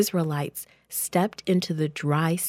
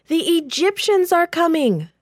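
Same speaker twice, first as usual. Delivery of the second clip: high, loud cry of warning; panicked shout; 10 semitones higher, 5 dB louder than usual. Acted. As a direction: yell it so the whole camp hears. Messenger runs through camp shouting.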